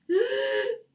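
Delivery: a high gasping intake before a sneeze; sharp pre-sneeze gasp. Acted. Wolf gasping as the sneeze builds. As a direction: catch the breath like a sneeze is coming.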